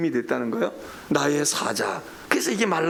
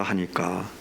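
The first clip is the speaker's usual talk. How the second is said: normal talking voice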